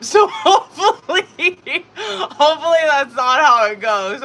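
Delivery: high, loud words through real laughter; laughing. Real, not acted. Streamer joking about viewers falling asleep, laughing loudly. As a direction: laugh your way through the hope.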